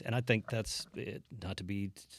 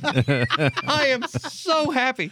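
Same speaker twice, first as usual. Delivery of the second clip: mock delighted; 11 dB louder, much slower than usual. Mock joy at womanhood.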